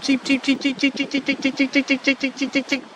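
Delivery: high, chirpy bird cheeps; osprey call imitation. Imitating the osprey's call to answer it, high chirping cheeps. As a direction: peep it out like a calling osprey. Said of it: "I don't know how to talk like an osprey".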